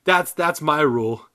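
ordinary talk, nothing marked

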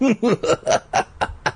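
airy gloating chuckle; breathy villain laugh. A narrator's reading. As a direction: laugh under your breath, smug.

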